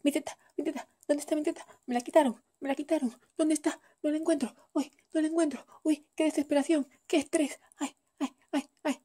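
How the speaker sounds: put-on high, fast panicky baby voice; a hungry baby hunting for the breast; desperate baby. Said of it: "Y hace así".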